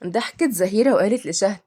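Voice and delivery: ordinary delivery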